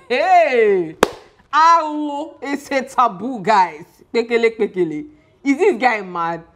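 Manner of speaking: gossip-thrilled shock at a taboo; scandalised exclamations. Real, not acted. Vlogger telling viewers about the throne taboo, excited and scandalised.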